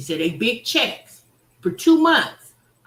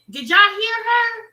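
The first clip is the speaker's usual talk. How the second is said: high, loud, thrilled call; excited call to chat; 10 semitones higher, 6 dB louder than usual. Real, not acted. Streamer shouting to chat about a commenter, high and excited. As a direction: rile up the viewers.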